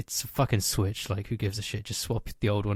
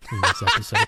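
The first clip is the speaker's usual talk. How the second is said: laughing; 3 dB louder than usual. Real, not acted. Laughing at own impression.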